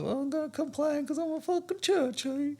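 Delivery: high whiny mocking churchgoer voice; mock churchy complainer. Host mocks a prissy church complainer in a high whiny voice. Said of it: "the churches are always like".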